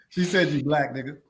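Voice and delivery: normal talking voice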